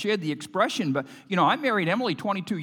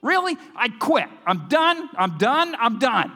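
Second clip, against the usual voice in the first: exasperated; 4 semitones higher than usual. Retold or imitated. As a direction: throw up your hands, ready to quit. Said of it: "and I'm thinking".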